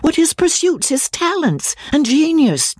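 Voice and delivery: lightly voiced indignant girl. A narrator's reading. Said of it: "cried Marianne indignantly".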